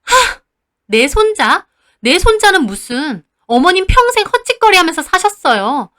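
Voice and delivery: loud, high contemptuous outburst; mother exploding at mother-in-law; scornful shout. Retold or imitated. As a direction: scoff then let it explode. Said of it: "갑자기 소리를 지르셨어요".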